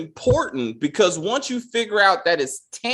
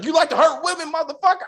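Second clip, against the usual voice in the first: high-pitched, worked-up imitation of a threat; retold threat; 10 semitones higher, faster than usual. Imitating detective choking Terrence, mock threatening. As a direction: act out the angry cop, pitched way up.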